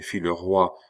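plain reading voice

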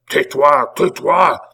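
high, loud, urgent silencing cry; outraged shout. A narrator's reading. Abbé refuses to hear Pitou's defence, shouting. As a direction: cut him off, shouting it twice.

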